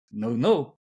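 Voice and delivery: normal talking voice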